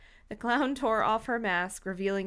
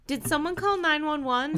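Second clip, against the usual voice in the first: higher, anxious call; crying out; 6 semitones higher, 3 dB louder than usual. Acted. Girl asks crowd about emergency call, crying out. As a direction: ask it urgently of the crowd. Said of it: "the girl cried".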